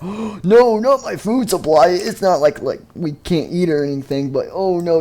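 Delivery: high, overdone protest; mock dismay. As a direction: wail in pretend alarm about the food. Villain panics over food supply.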